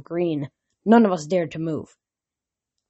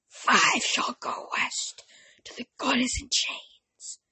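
oracle voice; 7 semitones higher, 7 dB quieter than usual. Acted. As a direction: breathe the prophecy like a possessed oracle. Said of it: "my oracle voice".

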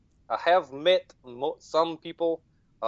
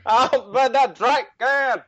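high, loud mimicked holler; mock yelling; 10 semitones higher, 6 dB louder than usual. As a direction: mimic the call in a raised, high-pitched yell. Mocks the shouted drink can line.

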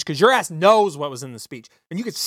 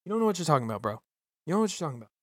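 much softer, half under the breath; muttered retort; 3 semitones lower, 11 dB quieter, much faster than usual. Retold or imitated. Podcaster retelling what he tells a coworker, under his breath. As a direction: grumble it low like a private aside. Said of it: "I'm just like".

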